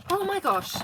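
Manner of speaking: loud, high-pitched outburst of exasperation; exasperated exclamation. Real, not acted. Crafter reacting to a disastrous punching attempt, exasperated exclamation. Struggle with the punch board ends.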